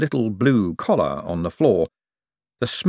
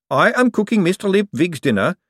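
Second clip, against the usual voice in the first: higher golem voice with a hint of defiance; a touch of defiance; 10 semitones higher, 3 dB louder than usual. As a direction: politely refuse to back down. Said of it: "with a touch of defiance".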